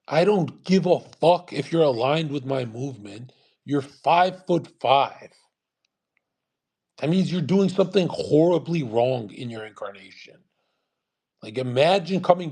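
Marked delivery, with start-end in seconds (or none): none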